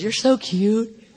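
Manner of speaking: slow, drawn-out cooing; stretched-out, indulgent baby talk; doting God. Acted. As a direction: coo it slowly at an adorable toddler. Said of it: "And God says".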